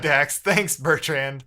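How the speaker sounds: ordinary talk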